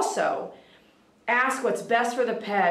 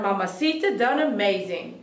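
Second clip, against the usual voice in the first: usual speaking voice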